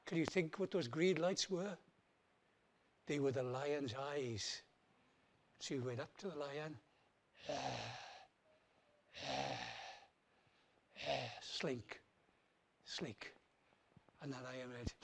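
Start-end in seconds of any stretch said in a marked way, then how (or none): 3.1-14.9 hushed suspense. A narrator's reading. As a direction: draw them into the dark cave. Entering dark lion cave.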